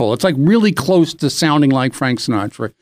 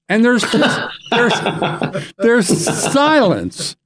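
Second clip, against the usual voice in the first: ordinary talk, nothing marked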